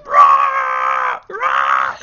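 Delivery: deep, loud mock roar; dragon roar. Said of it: "It's just like".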